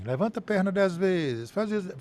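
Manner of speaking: mocking thin voice. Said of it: "com essa vozinha fina".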